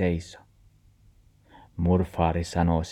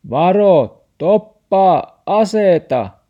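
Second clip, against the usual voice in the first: high, very loud warning shout; 12 semitones higher, 10 dB louder, slower than usual. Acted. Grandpa shouts a warning at Max, high and loud. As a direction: bellow the warning. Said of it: "Murfaari huusi".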